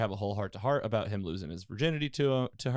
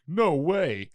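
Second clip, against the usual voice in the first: loud, higher-pitched exclamation; mock disbelief; 5 semitones higher, 8 dB louder than usual. Host retelling their own reaction to the story, loud disbelief. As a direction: blurt it out as a big reaction.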